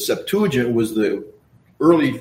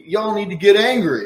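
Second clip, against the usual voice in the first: colloquial paraphrase; 6 semitones higher, 4 dB louder than usual. Retold or imitated. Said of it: "if I can put it in a colloquial form".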